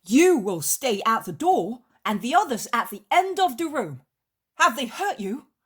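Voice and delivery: high, loud, swooping fussing; agitated ordering. Acted. Peter posts guards and checks on the master, agitated ordering. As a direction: give orders with the pitch leaping about.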